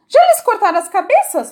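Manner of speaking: high, loud demand; queen shouting question. A narrator's reading. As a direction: demand it loudly. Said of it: "perguntou a rainha". (a rainha pergunta gritando, aguda)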